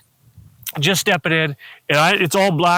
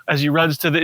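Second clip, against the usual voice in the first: normal talking voice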